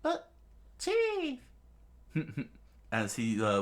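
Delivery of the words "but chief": tiny falsetto protest; very high child's voice; squeaky kid. Acted. Kid protesting to the young chief, squeaky.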